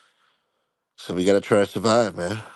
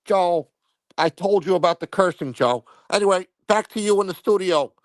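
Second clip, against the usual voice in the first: TV field reporter; 8 semitones higher than usual. Acted. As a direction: play a pompous on-scene reporter going live. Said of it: "We need Snuff to go live from the scene".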